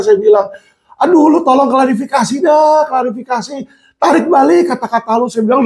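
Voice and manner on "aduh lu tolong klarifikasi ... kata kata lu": high, urgent plea to take it back; desperate pleading. Retold or imitated. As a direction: press him anxiously to retract. Inmate begging him to retract. (memohon dengan cemas)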